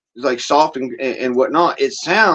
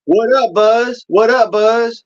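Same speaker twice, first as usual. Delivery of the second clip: put-on LA street accent; 7 semitones higher, slower than usual. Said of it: "They'll say".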